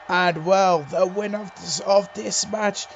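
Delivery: slow, loud winner announcement; ring announcement. Real, not acted.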